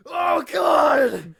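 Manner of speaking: loud, high cry of horror; pained shout. Acted. The captive sees his own arm and screams in horror. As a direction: yell as he sees what happened to his arm.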